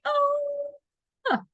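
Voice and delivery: very high imitated toddler scream; child's scream. Mom imitating her son's scream in the song, very high shriek. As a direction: shriek it like a little boy. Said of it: "Jacob would say".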